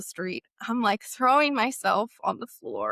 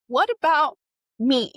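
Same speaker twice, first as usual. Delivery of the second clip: loud, higher-pitched wounded protest; hurt plea; 4 semitones higher, 6 dB louder than usual. Retold or imitated. Crying girl answering her accuser, hurt plea. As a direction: plead to be noticed, voice raised.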